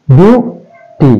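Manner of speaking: Beauty slowly split into বিউ and টি; syllable by syllable. Shown as a demonstration. Teacher repeating the two-syllable example, beauty divided. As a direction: pause between the two beats of beauty. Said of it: "বিউ একটা, টি একটা".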